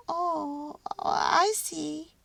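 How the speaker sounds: a tiny mouse understanding at last; sighing mouse. A narrator's reading. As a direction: mouse realising who the baby is. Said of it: "sighed Mortimer".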